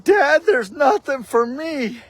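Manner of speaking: put-on high, crying child's complaint; tearful whine. Boy crying to his dad on Christmas morning, tearful and high. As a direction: plead through tears like a let-down kid. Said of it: "I'm just streaming, bawling".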